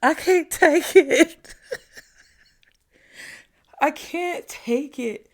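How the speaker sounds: slow, breathless laughing words; laughing shakily. Real, not acted. Vlogger laughing off the crash outside, slow laughing disbelief. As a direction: laugh it off, slowly, still rattled. Laughing after the loud bang.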